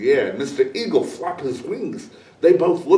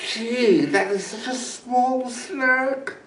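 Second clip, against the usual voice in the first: scoffing coyote; 7 semitones higher, 4 dB quieter than usual. A narrator's reading. Coyote laughs at the small bag, scoffing. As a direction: sneer at the small snack. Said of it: "laughed, and said".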